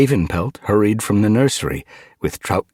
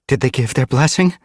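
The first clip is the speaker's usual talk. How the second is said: higher, louder, keen asking; eager question; 8 semitones higher, 5 dB louder than usual. A narrator's reading. Mothwing hurries to meet them for news, eager. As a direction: ask it eagerly, pitch lifted. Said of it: "she asked eagerly".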